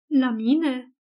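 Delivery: understated horror, in character; a touch of disbelieving dread; horrified question. A narrator's reading. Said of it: "Vocea Dianei era îngrozită". (întrebare cu o undă de groază)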